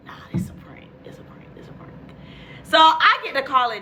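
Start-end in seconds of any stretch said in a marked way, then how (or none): 0.1-1.9 whispered, fading repetition; whispered disbelief. Retold or imitated. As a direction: whisper it over and over, trailing away.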